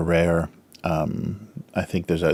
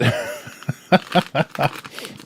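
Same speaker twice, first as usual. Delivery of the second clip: breathy giggle; 9 semitones higher than usual. Real, not acted. Laughing at the calories.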